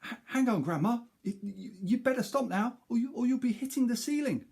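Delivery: put-on high, fast, stammering boy voice; a boy panicking at his grandma; young boy's alarmed plea; worried boy. Boy warns grandma in story.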